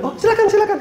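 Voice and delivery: very high, eager welcome; obliging host. Acted. Homeowner answering a polite photo request, high obliging welcome. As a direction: invite them in, high-pitched and ready.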